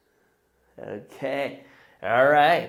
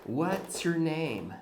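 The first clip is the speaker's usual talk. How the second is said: slow, clear question; 10 dB quieter, slower than usual. Shown as a demonstration. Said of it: "People will ask you".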